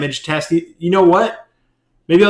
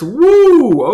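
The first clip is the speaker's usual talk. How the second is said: excited whoop; 10 dB louder than usual. Real, not acted. Big hit lands in game.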